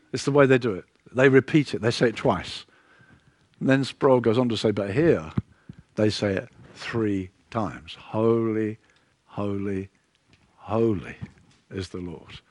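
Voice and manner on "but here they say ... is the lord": soft, low and slowly fading; hushed reverence. Acted. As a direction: lower the voice word by word into near-silence. Solemn quote of threefold holy.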